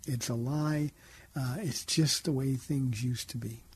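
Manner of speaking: ordinary talk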